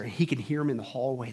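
ordinary delivery

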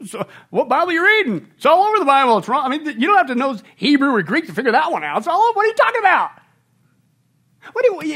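high, loud, fast mock outrage; hammed-up disbelief, voice climbing high; nervous incredulous rant. Christians scoffing at a friend's Bible, mock outraged. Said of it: "we laugh nervously, and we're going".

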